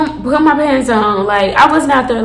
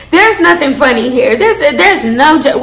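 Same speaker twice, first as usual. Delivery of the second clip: ordinary talk, nothing marked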